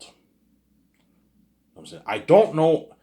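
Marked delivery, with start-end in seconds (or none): 2.1-2.9 excited. Real, not acted. Sudden high outburst.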